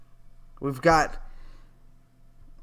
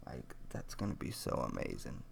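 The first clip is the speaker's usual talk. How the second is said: whisper; 12 semitones lower, 14 dB quieter than usual. Real, not acted. Joking aside about hairless legs.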